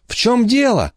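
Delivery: put-on high girl's voice yelling over wind; shouting over wind. A narrator's reading. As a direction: yell the question into the storm. Emma yelling over storm.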